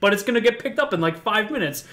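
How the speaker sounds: protesting. Retold or imitated. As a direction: imitate someone arguing back. Acted dog walker arguing back.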